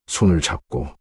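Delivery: ordinary delivery, nothing marked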